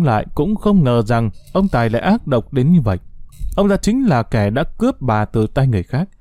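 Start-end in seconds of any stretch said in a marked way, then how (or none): none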